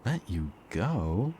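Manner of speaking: high, slow incredulous echo; mocking disbelief. Captor scoffs at plea for release.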